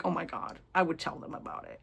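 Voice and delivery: hushed conspiratorial whisper; whispered aside. Retold or imitated. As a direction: lean in and whisper it like a secret. Imagined aside to the characters.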